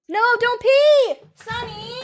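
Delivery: real high, drawn-out shout; alarmed shout. Real, not acted. Owner stopping the dog peeing indoors, alarmed shout. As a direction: yell to stop it right now, pitch leaping up. Calling out at dog about to pee.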